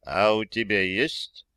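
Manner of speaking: slow, guarded question; suspicious, careful query; wary. A narrator's reading. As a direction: probe cautiously, drawing it out. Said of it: "Насторожился командир".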